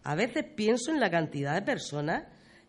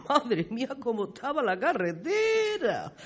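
chuckling lament, rising high on the last word; amused exclamation; 4 semitones higher than usual. Acted. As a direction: laugh through the exclamation, stretching the end. Amused exclamation about the old road. (exclamación entre risas, alargando el final)

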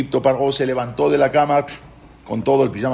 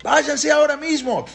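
high-pitched, drawn-out order; retold shouting; 14 semitones higher, slower than usual. Paró demanding they leave right now, high retold shout. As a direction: yell the command high and insistently. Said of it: "Les empezó a gritar".

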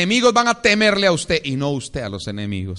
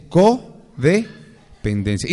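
very slow, rising codependencia; slow rising term; much slower than usual. Acted. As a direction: spell the diagnosis out slowly.

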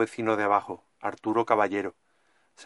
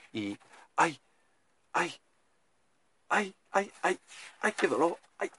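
pained cries; 6 semitones higher, 5 dB quieter than usual. Acted. Character seized by a heart attack.

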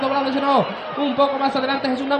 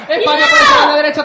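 excited commentary; 8 semitones higher, 6 dB louder, faster than usual. Real, not acted. Live commentary, attack starts.

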